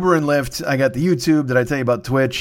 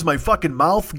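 ordinary talk

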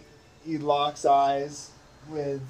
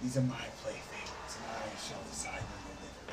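whispering, cold and quiet; whispered vampire threat; 4 semitones lower, 12 dB quieter, faster than usual. Acted. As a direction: hiss it softly as a predator. DM voices Strahd.

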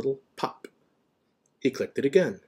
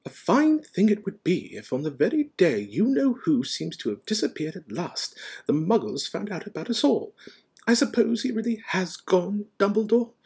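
indignant professor, high-pitched; McGonagall; 10 semitones higher, 5 dB louder than usual. A narrator's reading. McGonagall probing Dumbledore for news, tartly indignant. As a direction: press on tartly, ending on a question.

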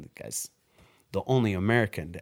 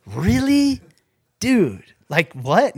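much higher and louder, like, what; stunned disbelief; 10 semitones higher, 11 dB louder, much slower than usual. Retold or imitated. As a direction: blurt it out, floored by the news. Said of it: "And I was like".

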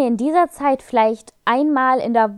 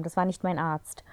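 whispering, as if telling a secret; whispered aside; 4 semitones lower, 9 dB quieter than usual. Real, not acted. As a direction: drop to a confiding whisper. Aside about the assistants.

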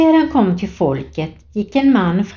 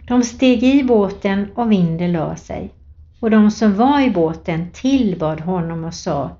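ordinary talk

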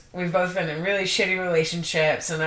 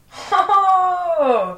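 high gleeful whoop; excited; 12 semitones higher, 5 dB louder than usual. Real, not acted. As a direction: whoop with glee. Excited exclamation.

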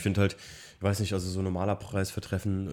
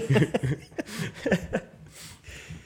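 long high laugh; 11 semitones higher than usual. Real, not acted. Laughs at toilet paper remark.

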